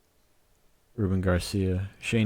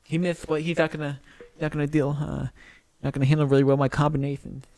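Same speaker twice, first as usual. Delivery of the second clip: put-on voice; 7 semitones higher, faster than usual. Mocking game voice.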